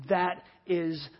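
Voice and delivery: ordinary talk, nothing marked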